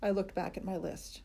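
usual speaking voice

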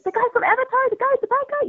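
very high, thrilled questioning; excited squeal. Retold or imitated. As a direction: starstruck recognizing the actor. Starstruck over the cast list.

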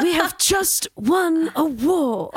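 slow, strained, high mock British prime minister; Margaret Thatcher. Mock Thatcher for podcast friends, slow and strained. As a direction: ham up a stiff, strained Thatcher. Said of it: "the Margaret Thatcher".

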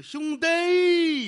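high, loud call of 兄弟; greeting brother loudly; crisp call. Acted. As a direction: ring out 兄弟 sharply. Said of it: "别兄弟".

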